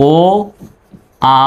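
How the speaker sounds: ও, pause, then আ; the "wa" glide split into ও ... আ; broken into sounds. Shown as a demonstration. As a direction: sound out ও and আ separately. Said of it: "ওয়া কিন্তু আমরা লিখছি".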